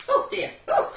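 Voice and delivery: sudden loud sneeze; sneezing. Real, not acted. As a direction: sneeze out hard, high and loud. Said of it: "just a sneeze".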